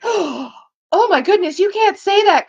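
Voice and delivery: a slow, high, loud gasped pearl-clutch; mock shock. Onlookers scandalised by an edgelord, mock outrage. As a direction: clutch your pearls at the edgy remark.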